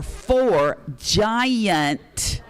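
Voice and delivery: "four" and "giant" stretched very slowly; drawn-out emphasis. Real, not acted. Auctioneer selling huge cookies to callers, "giant" slowly stretched. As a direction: hang on "giant" to sell the size.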